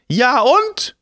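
put-on high, loud old crone voice; shrill old woman. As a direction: squawk it like a cranky old woman.

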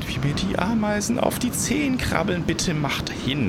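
high, squirming plea; anxious whining. A narrator's reading. The giant begs people to hurry, anxious whining. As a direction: whine as if ants crawl on you. Giant whines about crawling ants.

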